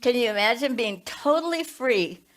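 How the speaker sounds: ordinary delivery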